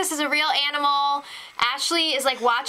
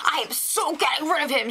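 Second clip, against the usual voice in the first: mock fired-up, slightly raised voice; fired-up housemate; 3 semitones higher than usual. Co-host mimicking a housemate set on revenge, mock fired-up. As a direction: imitate her getting all worked up. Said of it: "She gets so fired up".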